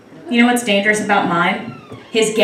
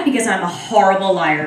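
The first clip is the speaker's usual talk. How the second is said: normal talking voice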